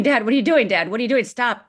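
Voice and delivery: mild replay of a terrified plea; retold scream. Girl screaming at father with gun, half-voiced panic. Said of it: "I start screaming".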